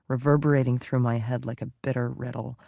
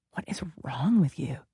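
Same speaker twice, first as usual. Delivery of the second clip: whisper; 3 semitones higher than usual. Acted. Asking her reflection after sleepless night.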